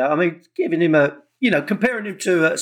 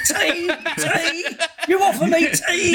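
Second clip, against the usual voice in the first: outraged shout; 11 semitones higher, slower than usual. Retold or imitated. Arteta outraged at tea.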